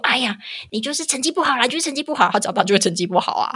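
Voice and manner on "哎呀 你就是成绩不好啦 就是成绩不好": high scolding parent voice. Retold or imitated. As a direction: nag the child, pitched up. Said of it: "从小都一直跟他讲说".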